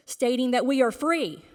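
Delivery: usual speaking voice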